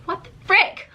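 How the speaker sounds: high, exasperated mock outburst; exasperated what the frick. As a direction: throw it up high in comic disbelief. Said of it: "and you're just like".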